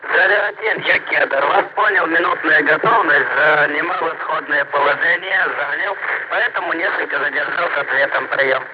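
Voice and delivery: crackly distant radio transmission; breaking up space radio. Real, not acted. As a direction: voice through a noisy radio link. Crackly radio link from rocket.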